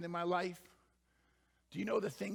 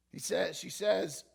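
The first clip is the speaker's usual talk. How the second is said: usual speaking voice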